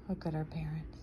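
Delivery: put-on conspiratorial whisper to the kids; hushed whisper about the parents. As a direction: lean in and whisper the secret.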